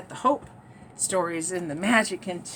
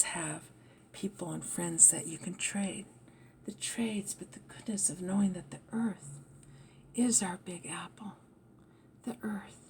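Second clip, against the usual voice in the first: soft unvoiced whisper; 4 semitones lower, 12 dB quieter than usual. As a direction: breathe it out like a secret. Whispers a spiritual message.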